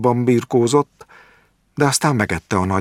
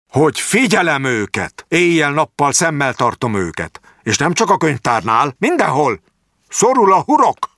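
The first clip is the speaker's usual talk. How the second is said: high, louder menacing threat building to a peak; raised voice; 9 semitones higher, 3 dB louder than usual. A narrator's reading. Jáki threatening the doctor on his way out, voice raised. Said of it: "emelte fel a hangját".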